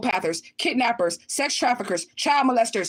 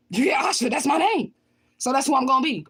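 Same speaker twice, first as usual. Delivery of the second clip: higher, emphatic shout; emphatic name claim; 4 semitones higher than usual. Real, not acted. Woman claiming her own name to viewers, emphatic. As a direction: proclaim it with force, pitch lifting.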